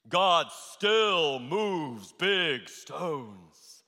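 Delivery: drawn out, every word weighted; very slow, solemn declaration; very slow proclamation. Acted. Preacher's slow proclaimed line.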